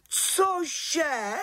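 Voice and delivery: high, scandalised cry; shocked exclamation. Acted. Head witch hears of Friday witchcraft, high shocked exclamation. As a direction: exclaim it in appalled disbelief. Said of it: "vykřikla vrchní čarodějnice".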